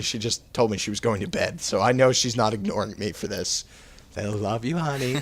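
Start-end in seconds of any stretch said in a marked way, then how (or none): 4.2-5.2 butter her up, softly; mock-sweet affection. To the girlfriend who may listen, mock-sweet and soft.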